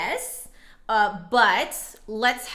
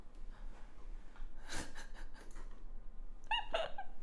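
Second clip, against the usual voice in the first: breathy silent laugh; 14 dB quieter than usual. Real, not acted. Laughing after rereading the name.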